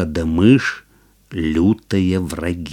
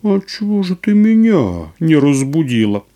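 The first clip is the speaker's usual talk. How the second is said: surprised cat; 7 semitones higher than usual. Acted. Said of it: "спрашивает".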